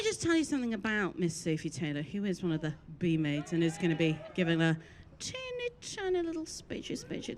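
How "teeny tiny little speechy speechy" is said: cutesy mock. Mocking the short speech.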